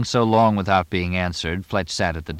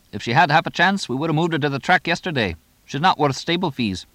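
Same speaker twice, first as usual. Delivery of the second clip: put-on quick, high English-accented patter; fast-talking English trainer; 8 semitones higher than usual. Trainer writing off a horse to its owner, brisk English accent. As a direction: rattle it off higher, like a chatty trainer.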